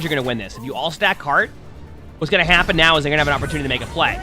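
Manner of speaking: ordinary delivery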